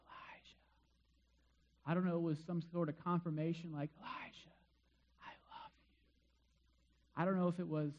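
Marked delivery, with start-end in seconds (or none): 0.1-0.6 a soft unvoiced whisper of the name; hushed, tender whisper. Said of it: "a gentle whisper". 4.0-5.9 soft unvoiced whisper of love; hushed loving whisper; gentle whisper. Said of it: "a gentle whisper".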